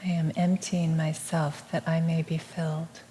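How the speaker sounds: soft, measured meditative repeat; hushed affirmation, evenly spoken; affirmation echo. Real, not acted. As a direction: repeat it gently as a quiet response. Echoes the affirmation.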